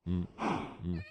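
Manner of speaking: ordinary talk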